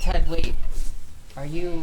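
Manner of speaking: calling out. Acted. Calling after a leaving friend.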